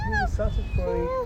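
high coaxing voice. Acted. Coaxing the cat.

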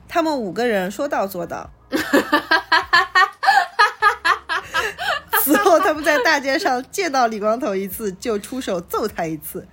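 high, loud burst of laughter through the words; laughing. Real, not acted. The reader, amused by the story, laughing loudly through the line. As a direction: crack up and read through it.

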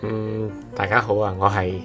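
usual speaking voice

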